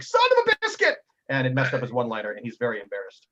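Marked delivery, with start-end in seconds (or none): 0.0-1.0 high, loud yelp of pain; mock curse. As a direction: shriek the minced oath. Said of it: "he's like".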